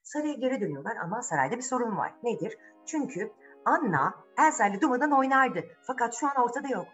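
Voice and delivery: ordinary delivery, nothing marked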